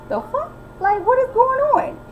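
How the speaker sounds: high, startled disbelief; shocked. Retold or imitated. Mo reacting to Kelly's yelling, high and shocked. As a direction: react like you can't believe it. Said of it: "So Mo is like".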